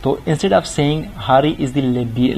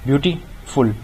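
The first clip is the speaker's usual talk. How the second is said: "Beautiful" in two chunks, beauty and फुल; split into syllables; slower than usual. Shown as a demonstration. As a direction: pause inside "beautiful", just before फुल. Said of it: "इसमें दो syllable आ गए". ("Beautiful" को beauty और फुल में तोड़कर)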